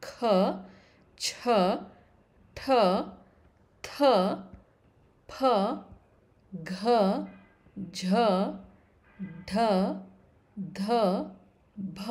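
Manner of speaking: aspirated ಖ, ಛ, ಠ, each with a strong puff; letters ಖ to ಭ spaced apart, heavily aspirated. Shown as a demonstration. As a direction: say ಖ, ಛ, ಠ, ಥ, ಫ, ಘ, ಝ, ಢ, ಧ, ಭ singly with extra breath. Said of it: "which require more breath".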